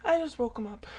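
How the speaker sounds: usual speaking voice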